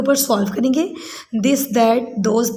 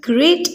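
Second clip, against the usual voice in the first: "great" higher, as one unbroken beat; one single syllable; 5 semitones higher than usual. Shown as a demonstration. Teacher shows pupils one sound, "great" in one beat. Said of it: "great में हमें single sound".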